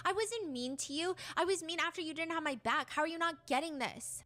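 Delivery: acted indignant outburst. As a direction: argue back as the misunderstood one. Reads an angry text argument.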